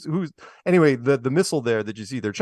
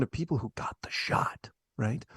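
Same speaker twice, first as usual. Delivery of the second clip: whisper; 3 semitones lower, 9 dB quieter than usual. Real, not acted. Aside about Michael Young.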